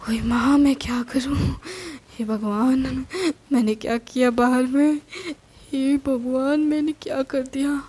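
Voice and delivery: a mother fretting in alarm, lightly acted; panicky. Mother panics to family after posing as police, frantic.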